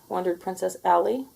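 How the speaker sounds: ordinary delivery, nothing marked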